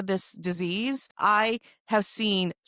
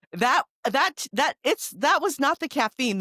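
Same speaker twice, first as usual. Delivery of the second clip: excited; 9 semitones higher, 5 dB louder than usual. Real, not acted. Excited punchline about coffee.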